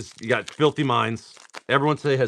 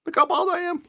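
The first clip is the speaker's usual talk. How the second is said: mock squeal. Shown as a demonstration. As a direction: Look how bald I am, jumping up high.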